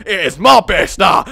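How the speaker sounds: loud Scottish shout; Scottish doctor. Acted. Scottish doctor seizes the moon base, shouted Scottish accent. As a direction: claim it as a roaring Scot. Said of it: "I'm having a free accented conversation".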